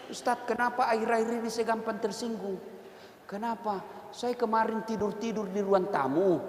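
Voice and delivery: a sulky retiree confiding to the preacher; soft complaining husband. Acted.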